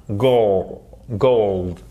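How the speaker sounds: "Gold" repeated with gliding oh; diphthong in gold. Shown as a demonstration. Teacher drilling learners, diphthong in "Gold". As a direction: separate model takes of "Gold", vowel open and gliding. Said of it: "On le fait pas avec le bout de la bouche".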